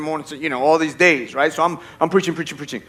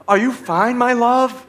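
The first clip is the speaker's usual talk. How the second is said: put-on, higher loving voice; prancing mock sweetness; 6 semitones higher, much slower than usual. Mocking an unreal husband to his wife, prancing sweetness. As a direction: coo it with exaggerated devotion. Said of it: "oh, you're, like, prancing".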